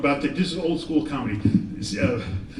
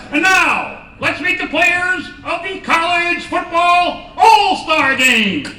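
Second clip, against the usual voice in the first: higher, louder, drawn-out announcer; 10 semitones higher, 8 dB louder, much slower than usual. Acted. Comedian parodying the football player introductions, loud and high. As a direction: hype it like a stadium announcer.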